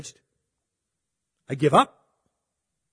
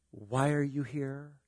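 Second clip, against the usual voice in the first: softer, slow and insinuating; 3 semitones lower, 11 dB quieter, slower than usual. Shown as a demonstration. Preacher shows how a question can incriminate, softer and slow. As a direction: let the doubt hang on each word. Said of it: "just by the way you say it".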